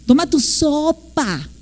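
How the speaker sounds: slow, stretched SOPA; exaggerated parental diction. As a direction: spell out "sopa" by ear for a toddler. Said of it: "hasta hacemos más dicción". ("sopa" lento y exagerado, como papá enseñando)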